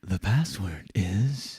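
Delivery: slow, soft hushed game show whisper; Kristen Wiig character. Retold or imitated. Host imitating a sketch character, hushed. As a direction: lean in and hush the password. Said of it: "the way you just did the password".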